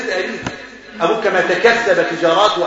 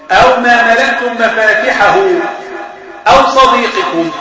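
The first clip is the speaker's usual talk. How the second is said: slow, higher and louder recitation; emphatic recitation; 4 semitones higher, 5 dB louder, slower than usual. Acted. Teacher reciting the verse's end to the class, slow and loud. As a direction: drive the last words of the verse home.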